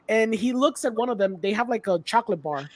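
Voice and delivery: ordinary talk, nothing marked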